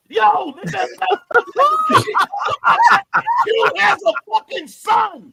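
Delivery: high, loud shouts of disbelief; loud, high-pitched hype outburst; excited shouting. Real, not acted. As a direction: yell it out, blown away by the reveal. Stunned reaction to plot twist.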